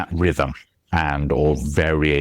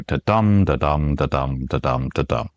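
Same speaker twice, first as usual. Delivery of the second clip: "Ta-dum" five times, stress on each "dum"; weak "da", strong "dum", five in a row; iambic beat; 3 semitones lower than usual. Shown as a demonstration. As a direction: chant "da-dum" evenly, falling away in pitch. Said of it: "five ta-tums in a row".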